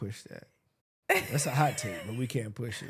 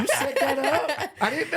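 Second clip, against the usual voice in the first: laughing teasing; 10 semitones higher, 4 dB louder, slower than usual. Real, not acted.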